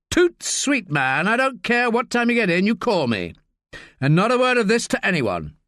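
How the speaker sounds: lightly voiced sardonic superior; harassed senior officer. A narrator's reading. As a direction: needle him, then order secrecy. Narrator voices Pennington.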